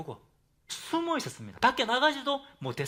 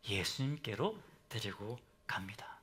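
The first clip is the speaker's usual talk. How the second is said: whisper; 10 semitones lower, 10 dB quieter than usual. Real, not acted. Sentence end whispered.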